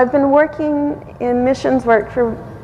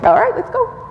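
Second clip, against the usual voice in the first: eager yes; 13 semitones higher than usual. Retold or imitated. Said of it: "And I'm like".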